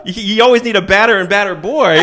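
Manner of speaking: high, loud, laughing sermon; laughing mock lecture. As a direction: preach it while cracking up.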